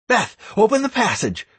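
high, urgent call across a battle; shouted order. A narrator's reading. Raid leader calling Beth to open the passage, shouted order. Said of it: "I continued to shout orders left and right".

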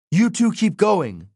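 high-pitched, pressing command; urgent order. A narrator's reading. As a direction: send friends on ahead. Frank orders friends to flee.

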